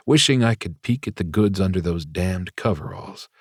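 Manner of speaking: usual speaking voice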